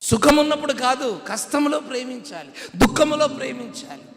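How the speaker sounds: loud, high pulpit shouting; full-force sermon shouting; fiery preaching. Acted. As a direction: thunder it at the congregation.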